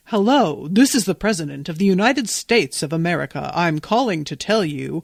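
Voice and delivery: put-on presidential announcer; mock-official announcer voice; prank-call voice. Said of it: "Just think of the prank calls".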